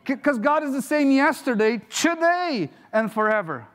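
high, ringing proclamation peaking mid-phrase; fervent preacher building up for an amen; rousing preaching. Acted. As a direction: proclaim it to a congregation, then settle on the last words.

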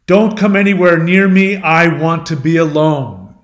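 storyteller's loud furious quote. Retold or imitated. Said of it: "he gets furious at this".

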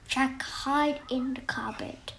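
hushed whisper. A narrator's reading. Narrator whispering as Jack hides. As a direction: whisper it like hiding.

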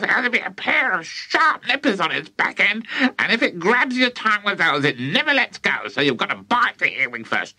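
put-on high, relishing old witch; witchy grandma voice. A narrator's reading. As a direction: savour every word like a greedy hag. Grandma relishes eating earwigs.

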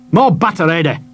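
snapping at his helper; curt, nasty order, pitched high; mean-tempered shopkeeper. Acted. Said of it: "equally nastily".

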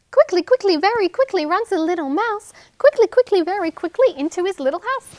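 fast, high and loud scurry; quick mouse rhyme. Shown as a demonstration. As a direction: rush it out like a running mouse. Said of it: "a mouse running up".

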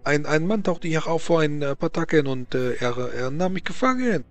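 put-on stammering Frenchman, heavy French accent; nervous French nobleman voice.

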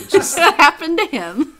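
high, loud, through laughter; laughing. Real, not acted. Host teasing her co-host about his vehicles blowing up, laughing. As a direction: laugh your way through the words. Laughing at friend's dice misfortune.

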